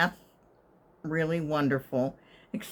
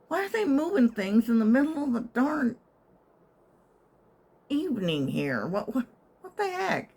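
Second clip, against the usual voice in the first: higher, fast, rising to an indignant pitch; exasperated; 7 semitones higher than usual. Retold or imitated. Annoyed at banging in the evening, exasperated. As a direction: climb into disbelief. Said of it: "and I'm like".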